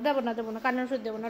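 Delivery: quiet coaxing version; softly coaxing no medicine. Real, not acted. Bargaining with the dog.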